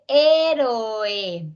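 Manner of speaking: "héroe" stretched, strong first E, vowels O and E sounded separately; hiatus demonstration. Shown as a demonstration. Teacher giving a hiatus example, "héroe" slowly drawn out. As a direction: draw out each open vowel of "héroe". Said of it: "Dos vocales abiertas".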